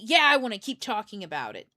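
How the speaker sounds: loud, defiant acted retort; still angry. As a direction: snap back at your own calm voice. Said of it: "do you still wanna be angry".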